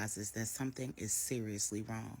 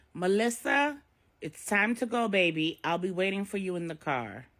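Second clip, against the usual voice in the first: put-on higher, louder call to her child; 7 semitones higher, 9 dB louder than usual. A narrator's reading. Jessica calls her daughter Melissa to leave, curt. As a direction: call across the house.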